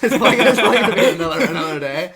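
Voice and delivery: bursting into laughter at your own joke; loud high laugh through the words. Real, not acted.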